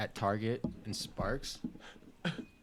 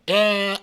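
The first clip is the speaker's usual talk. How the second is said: loud, high "eh" blurted out; nonsense radio noise; 7 semitones higher, 8 dB louder than usual. Retold or imitated. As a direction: blurt a silly noise into the mic. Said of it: "best th-, sensical thing".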